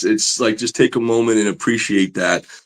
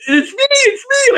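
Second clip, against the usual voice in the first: very high, excited squeak; tiny cartoon voice, bursting with eagerness; Mickey Mouse. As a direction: squeal it like an overexcited five-year-old. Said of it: "talking like Mickey Mouse".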